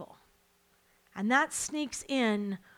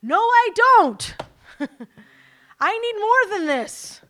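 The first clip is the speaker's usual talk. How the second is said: much higher and louder, slow defiant yell; defiant yell back at the inner voice; 9 semitones higher, 11 dB louder than usual. Retold or imitated. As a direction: shout it down with a laugh. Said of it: "And I yelled back".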